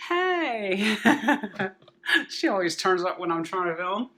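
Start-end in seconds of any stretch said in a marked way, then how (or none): 0.1-1.0 high, louder pet greeting; talking to a dog. Real, not acted. 1.0-1.7 short loud laugh at the dog. Real, not acted. Dog interrupts filming.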